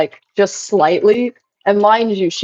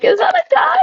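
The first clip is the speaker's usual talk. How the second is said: squeaky mock doubter. Imitating chat doubters.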